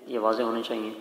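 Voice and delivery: ordinary talk, nothing marked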